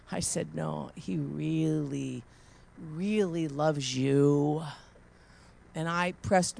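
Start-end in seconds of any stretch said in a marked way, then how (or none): 0.5-4.6 tender. Retold or imitated. Assuring a homeless woman of love.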